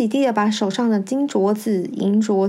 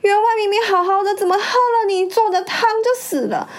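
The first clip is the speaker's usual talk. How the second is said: high, fast wailing accusation; 10 semitones higher, faster than usual. A narrator's reading. First wife blames her rival for the death, wailing. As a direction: cry out the blame in a high-pitched rush. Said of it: "马大奶奶跟着哭喊着说".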